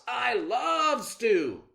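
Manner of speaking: high, loud, slow savoring; greedy fox. Acted. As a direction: relish the thought of stew. Fox craving stew.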